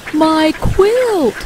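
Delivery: high quail wail; crying out in dismay. A narrator's reading. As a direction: cry out as a small, upset quail. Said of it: "cries Quincy".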